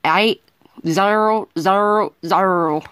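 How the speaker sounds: slow, loud, drawn-out southern accent on each letter and number. Shown as a demonstration. Reading a North Carolina plate number to viewers, slow loud southern accent. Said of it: "Let me speak in a southern accent fluently".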